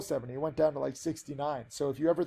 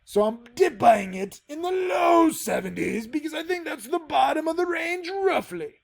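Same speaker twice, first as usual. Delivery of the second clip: Geoffrey Rush; 7 semitones higher than usual. Acted. Trader quoting Pirates of the Caribbean on guidelines, in a hammed-up pirate accent. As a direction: put on a theatrical pirate accent. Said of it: "really hard to do that accent".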